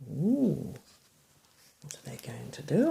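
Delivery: plain reading voice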